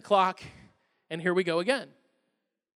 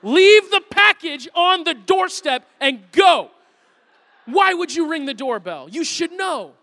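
loud, slow, indignant scolding; exasperated rant; 4 semitones higher, 7 dB louder, slower than usual. Retold or imitated. Tired dad scolding the FedEx guy, loud and slow. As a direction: let the sleepless parent boil over. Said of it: "I wanna open it and go".

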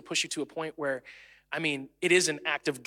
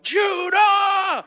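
top-of-the-lungs scream, much higher and louder; furious scream; 8 dB louder than usual. Retold or imitated. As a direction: yell the boy's name at full blast. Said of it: "I just scream".

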